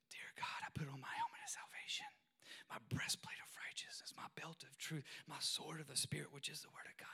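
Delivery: put-on hushed, earnest child's recital; whispered prayer. Four-year-old praying armor of God in hallway, whispered.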